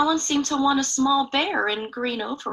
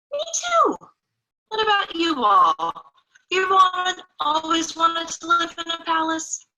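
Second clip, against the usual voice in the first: playful; 6 semitones higher than usual. Real, not acted. Asks young viewers playfully.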